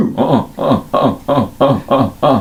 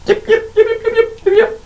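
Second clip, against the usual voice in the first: high, tiny kobold yips; yipping kobolds. Acted. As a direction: yap like a pack of little kobolds. GM voices kobold chatter.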